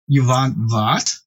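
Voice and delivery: slow, snooty offended artists; all attitude. Retold or imitated. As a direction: imitate haughty artists, drawing the words out. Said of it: "like, all attitude".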